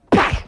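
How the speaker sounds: short harsh "bah" burst; imitated lunge noise; attack noise. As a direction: explode with a sudden attacking cry.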